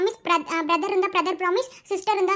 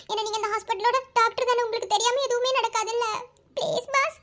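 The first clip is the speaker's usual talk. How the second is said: high, tearful begging; crying plea; 4 semitones higher than usual. Acted. Girl begging the doctor to let her see him, crying. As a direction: plead through tears, voice raised in pitch. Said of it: "இப்ப ஏன் நீ அழுதுட்டு இருக்க?".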